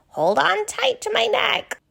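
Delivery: put-on a kind, worried young giraffe asking questions; Ginger the giraffe. Ginger offers a ride.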